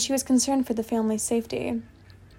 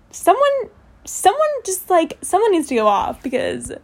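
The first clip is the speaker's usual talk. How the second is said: hammed-up high-pitched pleading for drama; mock exasperation; 7 semitones higher, 3 dB louder than usual. Reader wants someone to go off, mock exasperated.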